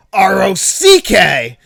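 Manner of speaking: loud, slow, spelled-out letters; mock spelling-bee shout. Retelling the spelling bee, Billy loudly spells rock, mock shout. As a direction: bark each letter out slowly.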